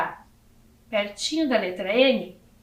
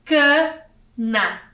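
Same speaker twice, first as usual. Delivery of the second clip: "cana" split, ca... na; syllable by syllable; 3 semitones higher, 3 dB louder than usual. Shown as a demonstration. Teacher reading a new word to children, syllable by syllable. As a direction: sound out "cana" slowly with a gap. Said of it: "Então, eu tenho aqui".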